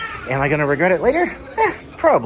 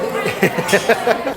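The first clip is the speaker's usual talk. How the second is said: bright laugh after a joke; teasing laugh; 6 semitones higher than usual. Real, not acted. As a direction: laugh at the tease.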